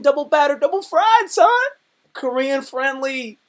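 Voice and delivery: hollering with laughter at the eater; hyped roommate. Retold or imitated. Roommate losing it over the bulgogi, high and loud. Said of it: "the roommates would be like".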